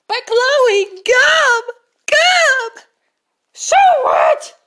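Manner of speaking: whiny pleading. Acted. Pleading over gum.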